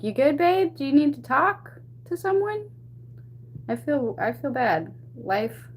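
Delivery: high, sweet put-on sympathy for a rival; mock concern. Painter mockingly offers the rude girl sympathy, in a high sugary voice. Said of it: "I'm just over here like".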